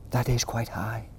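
soft private thought; thinking to himself. Acted. Little hare admiring his father's reach, soft thought. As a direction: murmur it in awe. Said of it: "thought Little Nut Brown Hare".

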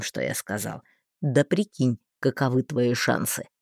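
low, condescending schemer; sly man voice. A narrator's reading. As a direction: talk down to her, low. Thin man lecturing.

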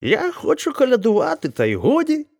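put-on an old man refusing to budge, voice still disguised. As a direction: stubbornly insist in the fake voice. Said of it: "тим же голосом".

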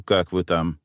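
ordinary delivery

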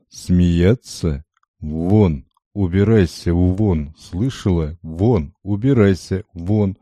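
loud angry roar of go away; furious shout. Acted. Man chases princess out of his home, shouting. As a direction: drive her out with a bellow.

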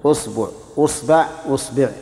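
أصبع said with u as the first vowel, the b taking u, then a, then i: usbu', usba', usbi'; three vowel variants. Shown as a demonstration. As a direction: say أصبع with u at the start and run the b through u, a, i. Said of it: "ضم الهمزة". (أصبع بضم الهمزة وتثليث الباء)

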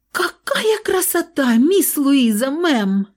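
high, slow, delighted cry; delighted exclaim. A narrator's reading. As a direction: gasp out in admiration. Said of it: "восхищённо воскликнула Земба".

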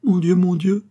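high, loud anguished moan; moaning in despair. A narrator's reading. Frightened mother moans to the detective, high and loud. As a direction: cry out to God in panic. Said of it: "Elle joignit les mains et gémit".

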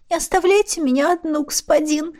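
put-on high, timid girl's plea; frightened babble. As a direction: lisp it out like a scared girl. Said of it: "пролепетала девчонка". (испуганно пролепетав)

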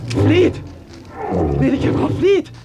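frantic shouted warning as the tiger charges; desperate shout. Acted. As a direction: scream at her to run.

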